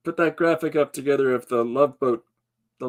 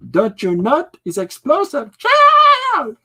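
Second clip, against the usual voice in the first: much higher, louder [name] cry ending in a shriek of "[name]!"; [name] impression; 8 dB louder, slower than usual. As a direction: belt out "[name]!" like a squealing showgirl. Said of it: "[name], there you go".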